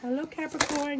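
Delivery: high lilting hello; a host's sing-song hello; bright sign greeting. Acted. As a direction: greet the viewers like a host. Sign greeting in announcer lilt.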